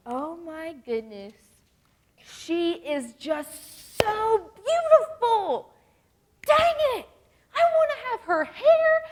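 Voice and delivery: hammed-up swoon, drawn out, leaping very high; exaggerated fangirl jealousy, slow then shrieky; gushing envy. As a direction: build to a high, loud mock squeal. Mimicking girls envying another girl.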